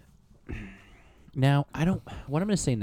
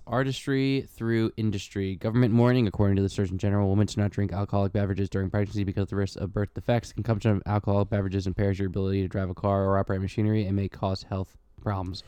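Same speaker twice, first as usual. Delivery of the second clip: label read; 4 semitones lower, faster than usual. Acted. Reads the can's warning label.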